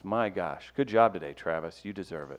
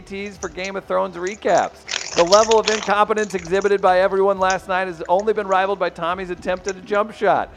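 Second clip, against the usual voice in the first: hyped reading; 6 semitones higher, 5 dB louder than usual. Acted. Reading submission with flourish.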